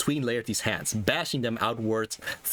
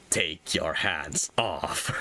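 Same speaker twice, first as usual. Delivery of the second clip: slow strained threat; 6 semitones lower, much slower than usual. Acted. Choked threat during the fight.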